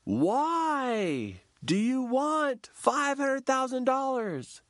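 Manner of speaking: high, slow probing question; facilitator. Retold or imitated. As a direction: stretch out the why, high and slow. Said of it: "the facilitator goes".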